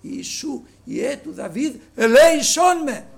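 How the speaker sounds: building to a loud, slow plea on «ελέησόν με»; crying out. Retold or imitated. Blind man calling to Jesus, slow loud plea. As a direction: cry out for mercy with the whole heart. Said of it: "φώναζε με όλη του την καρδιά".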